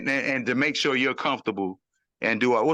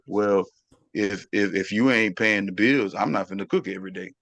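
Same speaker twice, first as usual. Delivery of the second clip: mock low, unimpressed woman's voice, lower; imitating a woman; 7 semitones lower than usual. Man mocking a girlfriend refusing to cook, lower and dismissive. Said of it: "it's like".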